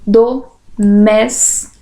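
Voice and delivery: slow, separated syllables in "Domésticos". Shown as a demonstration. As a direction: break "Domésticos" into syllables, pausing after each. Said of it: "Separação de sílabas".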